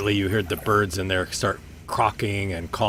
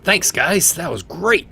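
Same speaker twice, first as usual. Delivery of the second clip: higher, louder cheerful thanks; grateful creature; 9 semitones higher, 7 dB louder, slower than usual. Acted. Healed dragon thanks the party, high and cheerful. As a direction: gulp it down and beam. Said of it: "He's like".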